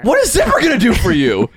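high-pitched amused disbelief; comically outraged question; incredulous. Real, not acted. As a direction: challenge it in a squeaky, baffled pitch. Mock disbelief at friend's choice.

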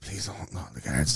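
soft, slow plea; retold pleading. Beaten man asking bystander not to report it, soft pleading. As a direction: beg quietly, drawing it out.

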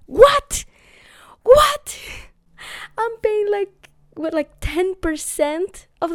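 high incredulous double take; shocked. Retold or imitated. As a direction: squeal it out in disbelief. Shocked by the price.

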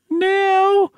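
put-on squeaky little boy asking; very high child voice. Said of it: "Let me do a different one for Tommy".

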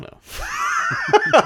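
high, drawn-out laugh through the words; cracking up loudly at how silly it is. Real, not acted. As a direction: burst out laughing while giving up. Laughing at their own silly premise.

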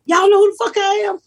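high, thrilled retold question; excited disbelief. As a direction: burst out amazed you are famous. Thrilled cast knows her name.

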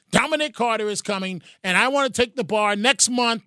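usual speaking voice

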